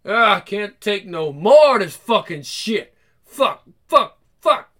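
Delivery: acted boiling-over frustration; exasperated outburst. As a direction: blow up at the end of your patience. Character snaps in acted scene.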